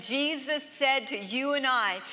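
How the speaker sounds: normal talking voice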